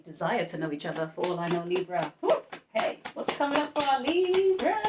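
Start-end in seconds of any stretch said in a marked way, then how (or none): 2.2-4.8 high, bright sing-song call to viewers; excited announcing. Real, not acted. Reader calling out to Libra viewers, excited and high. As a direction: greet the Libras like a show host.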